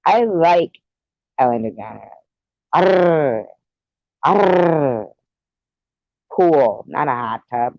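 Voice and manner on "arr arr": pirate growl. Pretend.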